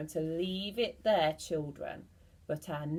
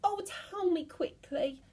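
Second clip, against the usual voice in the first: urgent begging; 10 semitones higher than usual. Acted. Mrs Twit begging.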